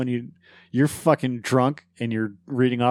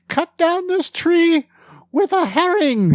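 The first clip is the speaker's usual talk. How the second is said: mock knight command. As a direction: proclaim it like a silly knight. Monty Python knight quote.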